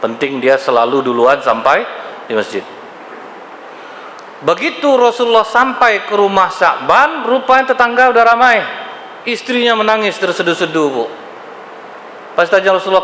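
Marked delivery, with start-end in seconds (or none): none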